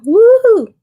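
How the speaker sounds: high, loud woo-hoo; excited whoop. Real, not acted. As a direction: cheer it out. Camera finally focusing.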